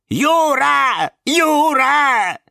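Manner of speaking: high, loud mock wail; mimicking mockingly. A narrator's reading. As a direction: imitate the screamer to tease him. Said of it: "передразнила его пародия". (передразнивающий вопль)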